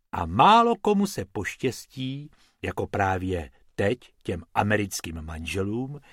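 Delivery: usual speaking voice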